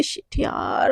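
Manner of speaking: breathy whispered curse under the breath; whispered aside. Acted. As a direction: mutter it so mom can't hear. Annoyed aside before scolding. (खुद से फुसफुसाकर झुंझलाहट)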